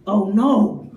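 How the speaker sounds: low, deadpan oh no; low deadpan sigh of dismay; flat disappointed. Retold or imitated. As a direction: let down, dry. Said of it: "she'd be like".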